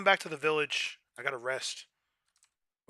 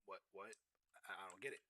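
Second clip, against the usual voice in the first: much softer, lower confused mumble; confused quiet; 7 semitones lower, 20 dB quieter than usual. Retold or imitated. Imitating himself confused by the slang, soft and low. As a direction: mutter it, puzzled. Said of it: "I'm like".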